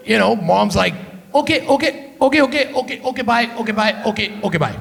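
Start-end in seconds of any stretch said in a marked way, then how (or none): 1.3-4.8 put-on rapid-fire repeated okays, Punjabi-accented mom; mom hanging up. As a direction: rattle off okays while trying to end the call. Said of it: "seventeen times before hanging up".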